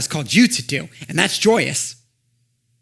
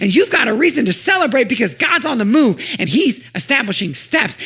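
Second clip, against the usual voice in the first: high, loud, building preacher voice; preaching at full force; 7 semitones higher, 4 dB louder than usual. Acted. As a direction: lift the crowd with rising joy. Preaching climax with music.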